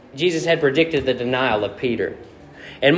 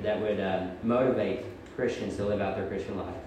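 soft, low, reverent prayer; 7 semitones lower, 10 dB quieter than usual. Real, not acted. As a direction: pray quietly and evenly, with small pauses. Leads the congregation in prayer.

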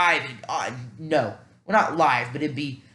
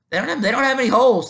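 exasperated outburst; 8 semitones higher, 6 dB louder, faster than usual. Real, not acted. Frustrated over mock draft pick.

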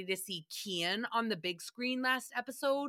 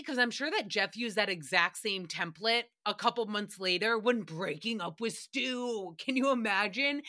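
ordinary talk, nothing marked

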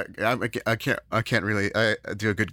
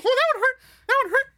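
very high, louder falsetto; squeaky mock Mickey; 4 dB louder, much faster than usual. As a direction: squeak it like a cartoon mouse.

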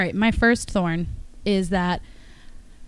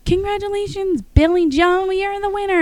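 higher, bright DJ announcement; radio announcer; 12 semitones higher than usual. Retold or imitated. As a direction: announce the winner like a radio host. Radio announcer names winner.

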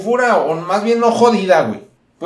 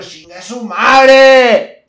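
a higher, louder, very drawn-out curse; slow mock shout; 7 semitones higher, 9 dB louder, much slower than usual. The narrator shouting back at the old lady, drawn-out mock yell. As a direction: stretch the insult out and yell it.

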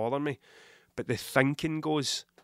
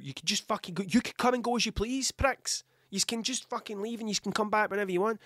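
exasperated; 6 semitones higher than usual. Acted. Voices exasperated address to his cats.

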